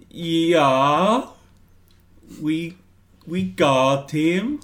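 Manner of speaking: high, halting put-on voice with a long drawn-out yeah; nervous bluffing. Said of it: "nervously pick up the radio and say".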